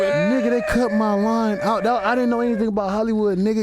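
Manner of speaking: high, worked-up disbelief; comic outrage. Real, not acted. Comic telling the host his line got cut, exasperated. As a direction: protest it like a wronged man.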